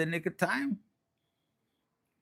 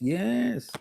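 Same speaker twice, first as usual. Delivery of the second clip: ordinary talk, nothing marked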